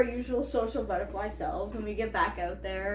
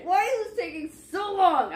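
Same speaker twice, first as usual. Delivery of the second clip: exasperated; 7 semitones higher than usual. Retold or imitated. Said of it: "lost it".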